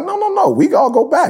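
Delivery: lightly acted friend's answer; mock Adam reply. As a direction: answer her in a put-on high voice. Voicing Adam's reply.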